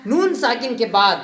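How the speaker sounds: ordinary talk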